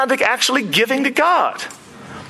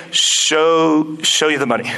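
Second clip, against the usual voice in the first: low, halting, half-hearted repeat; reluctant agent; 5 semitones lower, slower than usual. Retold or imitated. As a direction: go along with it unwillingly, pitched low. Retold agent reluctantly repeats the phrase.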